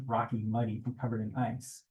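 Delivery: plain reading voice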